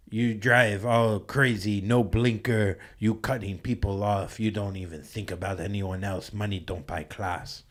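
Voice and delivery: imitating a Russian driver's scolding; Russian accent. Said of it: "He was, like, a Russian guy".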